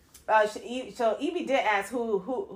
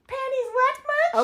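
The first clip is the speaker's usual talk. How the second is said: high mocking jab; 13 semitones higher than usual. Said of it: "I was like".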